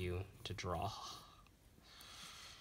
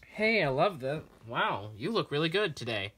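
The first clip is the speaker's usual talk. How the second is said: higher, louder, over-enthusiastic praise; sarcastic-sounding compliment; 6 semitones higher, 7 dB louder than usual. Acted. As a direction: gush so much it sounds insincere. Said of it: "sarcastic voice".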